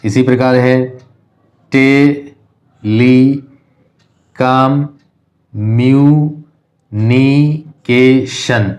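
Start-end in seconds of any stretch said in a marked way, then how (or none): 1.7-8.8 telecommunication extremely slow as te, li, com, myu, ni, ke, shan; teacher stretching telecommunication into seven isolated beats; syllable by syllable. Shown as a demonstration. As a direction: sound out telecommunication with a long gap after each syllable. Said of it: "distinguish करके देख लेते हैं".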